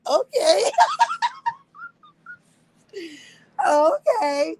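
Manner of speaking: high loud okay breaking into laughter; laughing okay. Real, not acted. Mocking his bus threat, laughing okay. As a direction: toss off okay and crack up.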